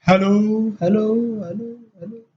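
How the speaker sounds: hello calls fading away; cave echo. Retold or imitated. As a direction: call out and let it echo. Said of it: "एक आवाज लगाते हैं".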